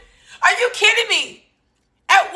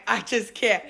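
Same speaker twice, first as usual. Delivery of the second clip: deflated; 7 semitones lower, 6 dB quieter than usual. Real, not acted. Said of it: "exasperated repeat".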